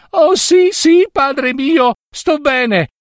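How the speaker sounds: very high, drawn-out emotional reply; effusive daughter. Acted. Daughter assuring her father she is fine, effusively.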